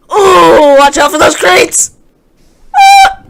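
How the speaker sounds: high, loud panicked cry; panicked yell. Real, not acted. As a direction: scream a warning at crates. Game panic, crying out.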